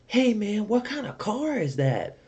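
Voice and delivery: high, slow mock kid voice; imitating kid. Mimicking the kid asking about his car, high mock voice. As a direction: ape the kid at the stoplight. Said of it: "he's like".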